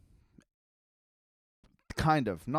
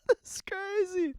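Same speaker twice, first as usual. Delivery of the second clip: high squeaky laugh into words; much slower than usual. Real, not acted. Friend's surprising disagreement.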